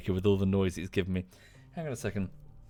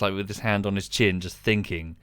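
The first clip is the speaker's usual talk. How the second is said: ordinary talk, nothing marked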